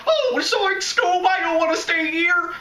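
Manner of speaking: very high, panicky cartoon voice; squeaky comic reluctance to stay; Shaggy. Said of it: "but Shaggy's like".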